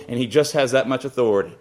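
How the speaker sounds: ordinary talk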